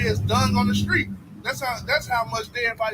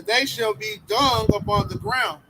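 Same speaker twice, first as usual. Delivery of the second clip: slow, heavy reading of the verse; slow scripture reading; 2 semitones lower, 4 dB louder, slower than usual. Acted. Reading a prophecy of doom, slow and heavy. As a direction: lean down hard on the doom.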